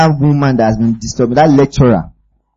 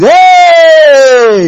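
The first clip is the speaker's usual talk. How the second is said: sudden high loud cry of alarm; startled yell; 13 semitones higher, 6 dB louder than usual. Retold or imitated. Preacher acting Superman startled by Kryptonite, high alarmed yell. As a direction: yelp like Superman jumping back.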